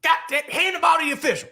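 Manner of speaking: high, yelling coach voice; Coach Pope impression. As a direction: bark it like a fired-up coach. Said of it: "my Coach Pope impression".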